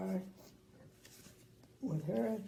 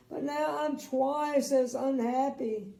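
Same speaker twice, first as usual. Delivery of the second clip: wailing; 5 semitones higher, 6 dB louder than usual. Acted. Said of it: "he wailed".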